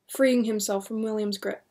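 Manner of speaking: ordinary delivery, nothing marked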